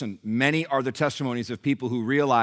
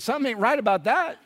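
much higher put-on voice of another patient; blunt challenger; 13 semitones higher, 5 dB louder than usual. Fellow patient confronting sour-faced Nelson, blunt high-voiced challenge. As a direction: challenge him in a high, acted voice.

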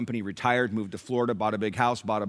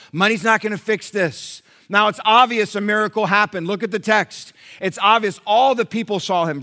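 high, driving pulpit voice; impassioned preaching; 10 semitones higher, 8 dB louder than usual. Real, not acted. Preacher expounding the miracle at the gate to congregation, impassioned. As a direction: preach it with mounting force, pitched up.

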